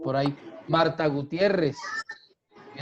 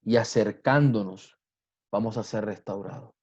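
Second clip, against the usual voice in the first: prayer; 4 semitones lower than usual. Acted. Pastor prays aloud to God.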